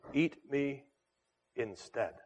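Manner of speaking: hushed, softening to almost nothing; quiet offer. Acted. Shepherd offering himself to the wolf, hushed and tender. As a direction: offer yourself gently, barely above a breath.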